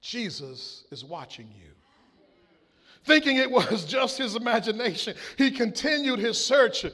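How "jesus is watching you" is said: very soft, low whisper-like voice. A narrator's reading. To the burglar in the dark, soft and low. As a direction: hush it, low and eerie. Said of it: "He heard a soft voice say".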